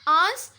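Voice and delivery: आंस drawn out loudly; loud, long आंस standing alone; stretched syllable. Shown as a demonstration. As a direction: hit आंस hard and hold it. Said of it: "बड़ा सा खींचते हैं".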